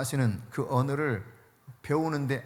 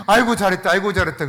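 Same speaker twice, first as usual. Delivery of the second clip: loud, cheerful praise; praising mother; 2 semitones higher, 8 dB louder, faster than usual. Retold or imitated. Pastor imitates a mother praising her child, loud cheerful praise. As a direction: cheer the child on like a proud mom. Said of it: "그러지".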